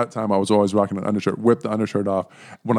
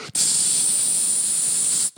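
imitated burst of steam; long steam hiss; 9 dB quieter than usual. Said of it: "it's like".

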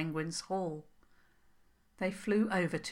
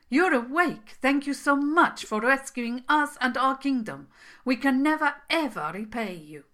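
higher and louder, gushing gratitude; excited thanks; 7 semitones higher, 7 dB louder than usual. Acted. Prince thanking the princess who rescued his kingdom, overjoyed. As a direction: burst out with delight.

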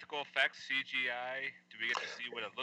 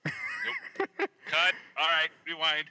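mock director; 6 semitones higher, 3 dB louder, much slower than usual. Joking as film director.

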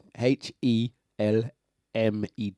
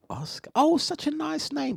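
fake politeness; 13 semitones higher, 5 dB quieter than usual. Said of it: "they'll go".

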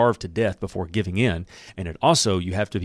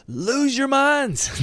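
much higher, slow, stretched shout of Lose your minds; Joshua's battle cry; much slower than usual. Acted. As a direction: roar Lose your minds out slowly like a commander. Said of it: "Joshua commanded the people".

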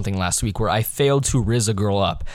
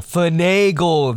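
higher, stretched-out "Finagle"; slow, emphatic "Finagle", pitched up; drawn-out word; 6 semitones higher, 4 dB louder, much slower than usual. Shown as a demonstration. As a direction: draw out the middle of "Finagle" slowly.

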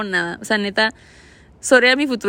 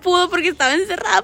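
ordinary delivery

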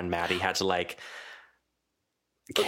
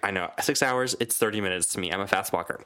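ordinary talk, nothing marked

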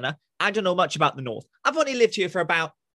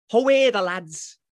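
imitated Geordie call, raised in pitch; Newcastle saying; 3 semitones higher, 4 dB louder than usual. Shown as a demonstration. Southerner showing off a favourite phrase, imitated Geordie. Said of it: "a Newcastle saying".

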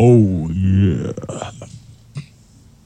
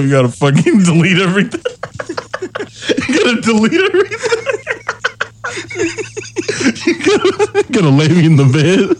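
talking fast through laughter; real laughing fit; 8 semitones higher, faster than usual. Real, not acted. Friend planning his embarrassing cover-up, cracking up laughing. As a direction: crack up while listing urgent instructions.

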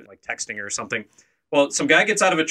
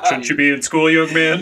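mock cop; 2 semitones higher, 6 dB louder than usual. Jokingly voices a scolding cop.

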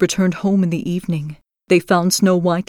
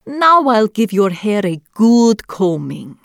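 put-on slow, sly old witch voice; old crone voice; 3 semitones higher than usual. A narrator's reading. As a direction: coax her in like a crone. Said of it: "the old woman said".

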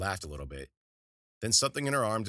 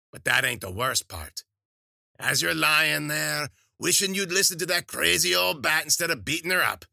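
gleeful threatening crone; 6 semitones higher, 6 dB louder than usual. A narrator's reading. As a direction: savour the threat then burst on pop. Witch threatening with cane.

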